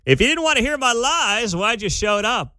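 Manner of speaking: high-pitched, worked-up sarcasm, imitated; sarcastic retort. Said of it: "you can hear the tone is clearly sarcastic".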